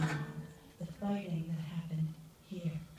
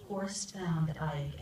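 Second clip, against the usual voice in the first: ordinary talk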